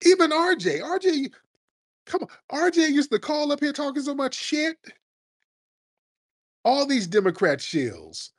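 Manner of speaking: high, excited call-out; incredulous high call-out. Real, not acted. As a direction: rise up calling him out by name. Calling out caller RJ.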